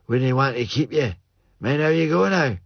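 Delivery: put-on old Joe boasting of his roots in broad Scots. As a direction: lean into the old Scots brogue.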